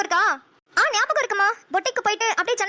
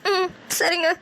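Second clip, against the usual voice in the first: tearful; much slower than usual. Acted. Daughter crying after parents' talk.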